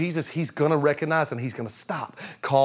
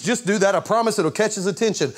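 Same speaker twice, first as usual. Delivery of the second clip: ordinary talk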